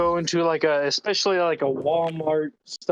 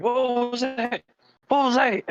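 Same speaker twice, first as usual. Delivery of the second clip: startled dog voice; 7 semitones higher, slower than usual. Retold or imitated. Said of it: "a dog wakes himself up like".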